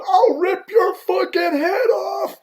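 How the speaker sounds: high, loud, drawn-out roar; enraged threat. Retold or imitated. Poked bear chasing the rep, enraged high scream. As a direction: scream the threat at full stretch. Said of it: "he goes".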